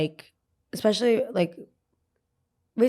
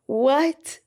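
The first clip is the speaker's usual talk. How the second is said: incredulous; 7 semitones higher than usual. Real, not acted. Baffled reaction to the dad.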